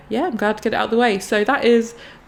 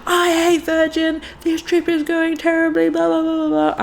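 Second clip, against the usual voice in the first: high, slow, overwrought complaining; a hammed-up sulky tantrum, pitched high; mock dramatic whine; 9 semitones higher than usual. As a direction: ham up a petulant rant, dragging it out. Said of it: "being like".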